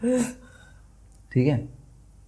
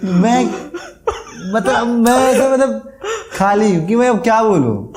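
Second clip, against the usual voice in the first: laughing; 11 semitones higher than usual. Real, not acted. Telling friend how speechless he was, laughing. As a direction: keep laughing as you speak.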